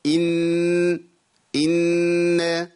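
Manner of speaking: إن with a long nasal hum; sustained humming إن; two-count ghunna. Shown as a demonstration. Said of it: "بنغنها حركتين".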